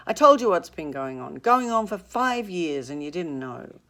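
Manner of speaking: loud, insistent retort; forceful retort. Acted. Gatsby telling Tom the affair, loud and forceful. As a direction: throw it back at him hard.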